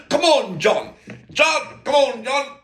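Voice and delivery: high, loud Aussie call up the stairs; hollering like an Aussie instructor, lilting; mock Aussie call. Instructor calling John down into the water, in a mock Aussie accent. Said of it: "They, they inflect, I can't get it right".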